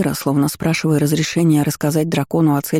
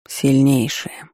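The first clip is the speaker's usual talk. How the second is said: dragon rumble; 2 semitones lower, much slower than usual. A narrator's reading. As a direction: let it roll out slowly like a dragon. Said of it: "дракон пророкотал глухо". (глухой рокот дракона)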